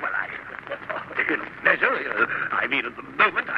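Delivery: halting, stammering reply with long breaks; flustered stammering. Acted. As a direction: stumble from phrase to phrase, never finishing. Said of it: "stuttering and a stammering around like that".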